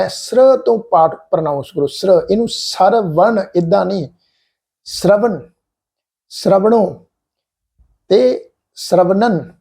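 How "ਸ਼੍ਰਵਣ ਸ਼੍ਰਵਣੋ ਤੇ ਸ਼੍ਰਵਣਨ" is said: ਸ਼੍ਰਵਣ, ਸ਼੍ਰਵਣੋ, ਸ਼੍ਰਵਣਨ each with sr joined, slow at the end; model reading. Shown as a demonstration. Teacher closing the lesson with a slow model recitation. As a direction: recite the three forms slowly, sr as one cluster. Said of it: "ਇਸ ਢੰਗ ਨਾਲ ਪਾਠ ਉਚਾਰਨ ਕਰੋ".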